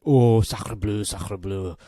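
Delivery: hammed-up fake French accent, loud; mock the French with a cartoon French accent. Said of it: "everyone will be like".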